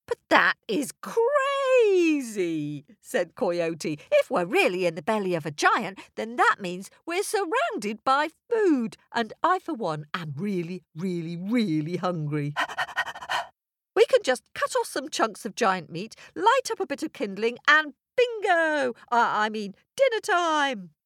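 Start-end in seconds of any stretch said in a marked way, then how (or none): 0.1-2.8 slow, high trickster voice; drawn-out disbelief as Coyote. Acted. Coyote reacts to the crowd. 4.1-20.9 fast, high eager trickster; excited Coyote. Acted. Coyote pitches his meat plan.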